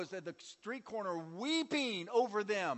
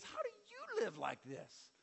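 much higher, pleading question; pleading to God; 13 semitones higher, 5 dB quieter than usual. Retold or imitated. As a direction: plead with God, voice rising high. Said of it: "And I said, "God".

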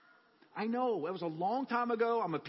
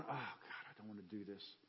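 much softer, lower, dreading groan; dreading the church door, sitting in the car; reluctant groan; 11 semitones lower, 16 dB quieter than usual. Retold or imitated. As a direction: mumble it to yourself in dread, very soft. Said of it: "I'm sitting in my car going".

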